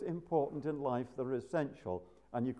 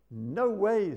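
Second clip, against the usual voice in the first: louder, higher emphatic no; mock-divine no way, louder and higher; God's flat refusal; 7 semitones higher, 6 dB louder than usual. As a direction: shut the request down hard. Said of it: "says God".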